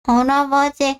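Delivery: put-on a shy toddler greeting grandpa; small child. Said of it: "할아버지 해야지". (할아버지에게 인사하는 어린아이 목소리)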